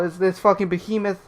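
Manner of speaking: ordinary delivery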